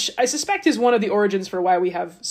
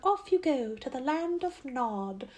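much softer, slow bedtime send-off; parent lulling child; 5 semitones higher, 9 dB quieter than usual. Retold or imitated. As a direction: coo it like tucking a child in. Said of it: "what parents would say to their children".

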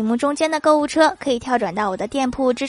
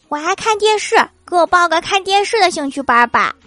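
put-on high, childlike pleading voice; childish voice; 7 semitones higher, 4 dB louder than usual. Little son begging his mom for a TV class, childlike. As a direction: wheedle it like a little kid.

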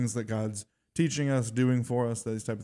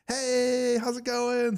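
high, loud cheery hello; man in the school greeting her, loud cheery hello; super happy greeting; 5 dB louder, much slower than usual. Retold or imitated. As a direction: greet her like a beaming friend. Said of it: "Super happy".

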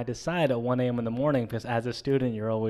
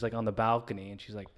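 ordinary talk

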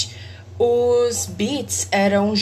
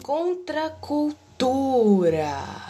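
slow, drawn-out big reveal; dramatic reveal; 3 semitones higher, much slower than usual. Pretend. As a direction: unveil the word like a grand announcement. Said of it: "que rufem os tambores". (revelação dramática)

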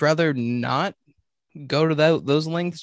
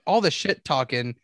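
usual speaking voice

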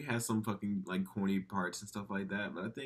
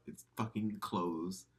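usual speaking voice

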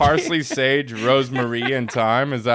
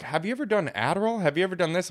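quick, higher questions in mild imitation; imitating nosy people; 6 semitones higher, faster than usual. Host quoting people who ask about drugs, mild imitation. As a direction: lightly mimic people asking, voice lifted. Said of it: "people are like".

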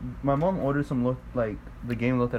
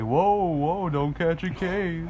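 higher, drawn-out teasing warning; mock warning; 5 semitones higher, much slower than usual. Imitating friends teasing about age, mock warning. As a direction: caution them teasingly, pitched up and slow. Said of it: "y'all be like".

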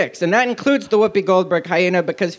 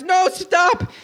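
very high acted cry to stop; high-pitched comic plea; frantic protest; 11 semitones higher than usual. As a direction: shout it out in mock panic. Character begs another to stop.

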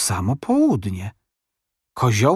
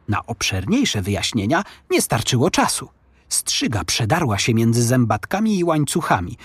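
ordinary delivery, nothing marked

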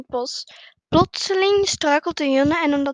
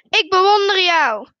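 loud, raised admiring shout; calling out; 4 semitones higher, 8 dB louder than usual. A narrator's reading. Father shows his son, loud high call. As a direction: call it far across the mountains. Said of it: "en roept".

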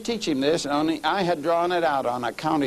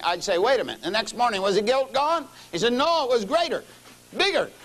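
retelling the story in a raised, higher pulpit voice; preaching at full force; 9 semitones higher than usual. Acted. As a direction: keep the sermon fire going. Preacher retells criminal's story fervently.

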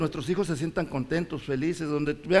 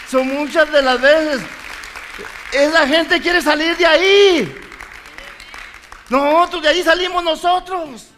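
preaching at full force; 6 semitones higher, 6 dB louder than usual. Acted. Preacher to congregation, a loud triumphant testimony. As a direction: lift the church up with each line.

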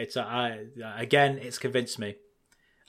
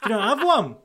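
teasing; 9 semitones higher, 5 dB louder than usual. Pretend. Teasing mock conspiracy argument.

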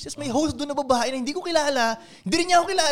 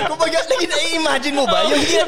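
high, loud and slow, laughing in disbelief; laughing outrage; 6 semitones higher, 5 dB louder than usual. Real, not acted. Telling friends about the worst seat, laughing indignant. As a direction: stretch it out, half-laughing, indignant.

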